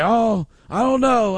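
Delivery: mock a fretting grandma; worried grandma. Grandma fretting over the fallen grandson, worried. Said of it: "she used to always get worried".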